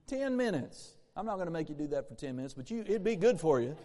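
ordinary talk

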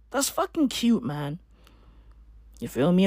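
ordinary delivery

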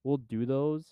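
usual speaking voice

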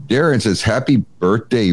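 ordinary delivery

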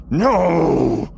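high shrieking possessed voice; possessed shout. Acted. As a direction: scream it like a possessed spirit. Possessed Voria shouts at party.

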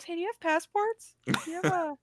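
high, soft, clueless voice; ditzy android asking for passports; Kara voice. Retold or imitated. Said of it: "she goes to Marcus and goes".

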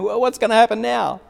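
high, bright, expectant voicing of the friends; eager onlookers. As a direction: peer down eagerly, waiting to see. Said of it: "they're saying".

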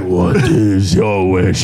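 deep slow booming dragon; slow, low wish-granting voice; genie dragon voice. Retold or imitated. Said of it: "he's like".

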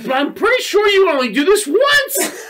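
high, loud comic disbelief; baffled protest. Retold or imitated. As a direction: exclaim it, amused and incredulous. Retold baffled outburst about the toy.

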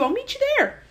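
high, sing-song fake promise; a sneaky high lilt, lying sweetly; mock-innocent high voice; sly Jacob.

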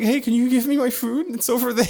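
lachend zitiert, viel höher; laughing quote. Retold or imitated. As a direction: kichernd die eigene Bitte nachspielen. Retold request to the nurse.

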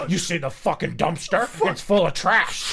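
imitating a griping coworker; gruff complaining impression; mock grumpy rant. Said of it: "you get that guy".

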